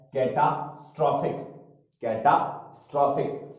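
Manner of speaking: कैटास्ट्रॉफिक drawn out slowly, syllable by syllable; slow careful pronunciation. Shown as a demonstration. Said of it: "spelling का नाम सुनो". (धीरे धीरे कैटास्ट्रॉफिक का उच्चारण)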